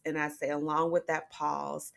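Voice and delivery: normal talking voice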